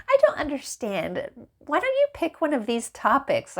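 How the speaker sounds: a lightly mocking canned reply; mock chatbot. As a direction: mimic the clueless bot. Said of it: "it's like".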